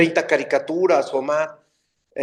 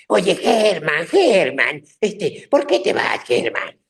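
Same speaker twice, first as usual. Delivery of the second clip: grandpa voice; slower than usual. Retold or imitated. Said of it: "Con una voz así muy padre".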